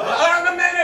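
high, loud call through the door; yelling from the stall. Retold or imitated. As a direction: shout to the person knocking.

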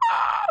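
short, very high shriek; frustrated shriek. Real, not acted. Player after double jump scare, shrieking. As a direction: shriek out the fright.

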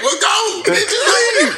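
high, loud mock yell telling them to leave. Telling a classmate to leave, high loud mock yell. Retelling a heated class argument.

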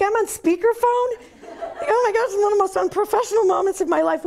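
high, loud embarrassed laughing; laughing mortified. Real, not acted. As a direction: laugh through the horror of it. Realizing she is on speakerphone.

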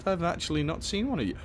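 usual speaking voice

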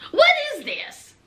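high-pitched, incredulous question; acted-out baffled outrage; appalled disbelief. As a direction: send the pitch way up in mock disbelief. Son appalled at the stage scene.

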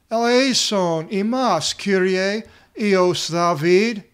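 slow, loud, high pleading cry; crying out for mercy. Retold or imitated. Blind men begging Jesus for mercy, a loud slow cry. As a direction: call out to Jesus across the crowd, drawn out. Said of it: "They're yelling out".

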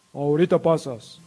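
lightly voiced burly guard; imitated security heavy; bouncer. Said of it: "dos gorilas, que dijeron".

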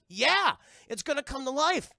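loud, high emphatic confirmation; exasperated yell. Retold or imitated. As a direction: yell it as the obvious danger, imitating her. She answers in alarm.

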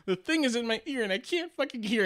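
put-on high, panicky child's voice; scared kid. A boy telling his mom the swab is stuck, high and scared. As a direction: blurt it out like a frightened little kid. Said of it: "And I was afraid".

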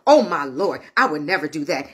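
low-pitched imitation of a pious promise; mock-earnest low protest; solemn vow. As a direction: vow it to the Lord, pitched well down. Believers' vow to the Lord re-enacted.

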